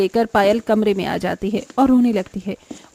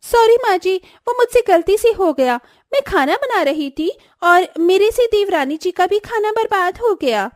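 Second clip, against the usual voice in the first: high, whiny put-on apology; fake apology; 8 semitones higher than usual. Swati pretending to be sorry to her mother-in-law, high and whiny. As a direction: play innocent in a high, whining voice.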